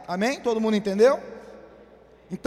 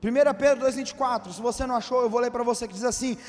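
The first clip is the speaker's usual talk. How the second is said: normal talking voice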